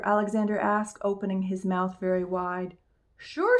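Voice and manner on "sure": put-on high child voice; cheerful small boy; little boy voice. A narrator's reading. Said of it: "said the little boy".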